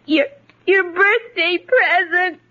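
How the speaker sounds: crying. Acted. Girl revealing father's birthday surprise, crying. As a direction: catch on the first word between sobs. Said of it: "please stop crying".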